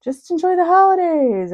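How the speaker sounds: high, sing-song well-wisher voice; mock-cheerful and high-pitched; cheery well-meaning people. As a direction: imitate people breezily wishing you well, voice way up. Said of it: "when people were like".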